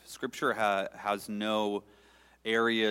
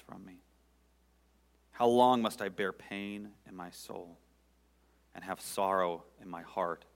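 lamenting psalm reading. Real, not acted. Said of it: "read this with my laments in my heart".

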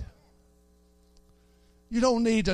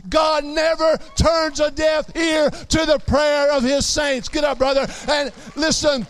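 full-force preaching; 6 semitones higher than usual. Acted. Preacher shouting to the congregation about prayer, full-force preaching. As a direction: preach it out to the whole church.

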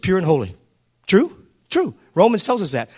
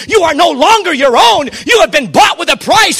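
loud, high preacher's cry over music; preaching at full force; 6 semitones higher, 7 dB louder than usual. Real, not acted. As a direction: shout the victory to the church.

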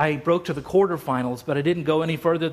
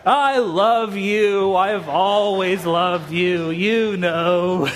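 slow, louder, choked with tearful emotion; tearful reconciliation; 4 dB louder, much slower than usual. Retold or imitated. To his speech coach after their fight, tearful and slow. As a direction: say it like you're crying and making up. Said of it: "we started crying with each other".

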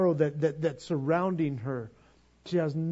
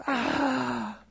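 anguished groan; 8 semitones higher than usual. Retold or imitated. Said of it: "I'm going".

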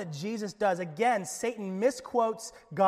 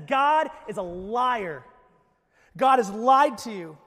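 accusing serpent; 9 dB louder, slower than usual. Acted. Voicing Satan's denial loudly.

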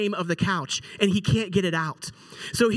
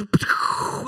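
spat-out explosive whoosh; voiceless launching sound effect; imitated whoosh. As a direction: make the noise of someone flung across a room. Imitates Josh flying across room.

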